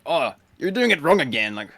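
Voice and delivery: lightly acted complaining player; imitated exasperated raiders. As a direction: imitate a fed-up teammate telling someone off. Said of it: "Like, people are like".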